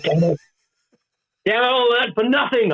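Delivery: high-pitched comic lament; hammed-up exasperated complaint; mock frustration. Acting out frustration at no sales.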